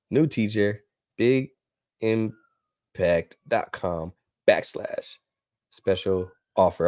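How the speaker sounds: very slow, word by word, with gaps; slow dictation. Real, not acted. Host typing the link into the chat, slow dictation. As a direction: spell it out at typing speed.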